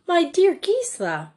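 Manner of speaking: high, shocked cry of a name; shocked gasp. A narrator's reading. Shocked headmistress exclaiming to Gisela. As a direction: headmistress taken aback by a pupil's question. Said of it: "Miss Bettany gasped".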